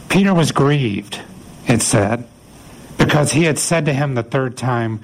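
ordinary talk, nothing marked

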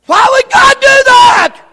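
shouted rhetorical question; preaching shout. Real, not acted. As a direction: cry it out to the room.

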